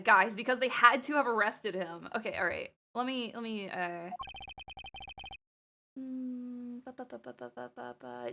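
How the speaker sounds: ordinary delivery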